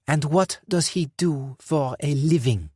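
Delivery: sly villain; probing boss. Acted. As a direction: dig for the answer. Dufour probing.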